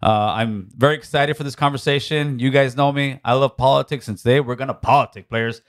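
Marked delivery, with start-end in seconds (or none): none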